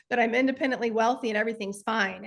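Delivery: normal talking voice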